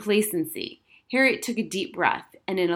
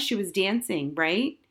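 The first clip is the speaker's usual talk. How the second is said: ordinary delivery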